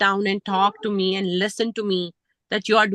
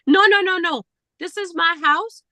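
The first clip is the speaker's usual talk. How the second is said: insistent mock yell; 7 semitones higher, 4 dB louder than usual. Father-in-law insists.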